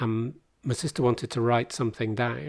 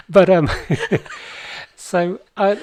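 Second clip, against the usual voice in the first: laughing; 7 semitones higher, 8 dB louder, slower than usual. Real, not acted. Laughs at his imaginary friends.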